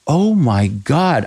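slow, drawn-out stunned oh my God; retold shock. Storyteller recalls hearing the insult, slow and stunned. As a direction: let the disbelief sink in slowly. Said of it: "And I'm going".

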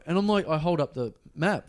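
usual speaking voice

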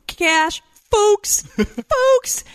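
put-on very high kid voice; imitating amused child. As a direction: squeal it like a tickled little boy. Mimicking son crowing the misread word.

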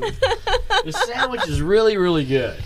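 laughing hard through words; high laughing insistence. Real, not acted. Laughing while insisting sandwich good.

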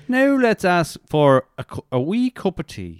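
slow imitation of a Donegal Irish teacher; drawn-out Donegal lilt; Donegal accent. Imitating a Donegal podcast teacher, slow Donegal accent. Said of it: "Donegal accent on point there".

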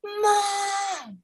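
high shout for mom; scared child calling mom. Retold or imitated. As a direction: yell for mom in a panic. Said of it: "she screamed for her mother".

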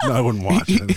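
laughing it off. Real, not acted. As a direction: talk through the laughter. Laughing after dark joke.

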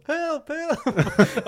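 mock scream for help. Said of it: "skriker hon".

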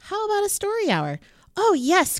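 put-on high, fast, eager kids; excited. A narrator's reading. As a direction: delight at story hour. Teacher offers, kids cry.

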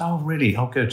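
high, bright mock interest; dad quizzing his daughter after the pub, high bright mock interest; mock pleased. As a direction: play it up as the keen dad. Dad voicing himself in car.

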